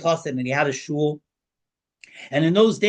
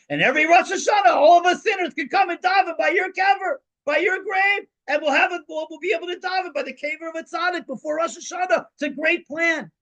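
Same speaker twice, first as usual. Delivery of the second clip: manic gleeful plan. Acted. Chassid to the visiting preacher, shouting his mad plan gleefully. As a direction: crow it out like a madman pleased with his scheme.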